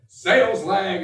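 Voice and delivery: loud mocking cry of sales lag; imitating management crying out; mock yell. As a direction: bark it like a panicked boss. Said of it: "they yell".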